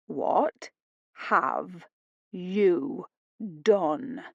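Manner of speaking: calm, measured and level. A narrator's reading. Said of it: "in a calm, level voice".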